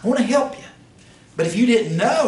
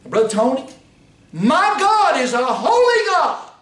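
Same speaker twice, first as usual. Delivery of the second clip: preaching fervor; 8 semitones higher, 4 dB louder than usual. Acted. Preacher exhorts Brother Tony.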